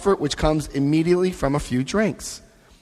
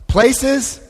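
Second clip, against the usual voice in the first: normal talking voice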